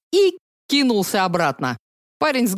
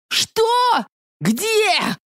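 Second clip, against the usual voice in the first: high, frantic questions; panicked; 3 dB louder than usual. Acted. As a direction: look around in panic.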